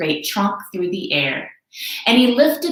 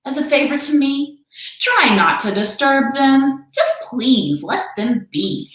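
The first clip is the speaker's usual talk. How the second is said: higher, slowing gentle plea; 7 semitones higher than usual. Acted. Horton begs the kangaroo, higher and slowing. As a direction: ask kindly, almost begging. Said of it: "Horton said".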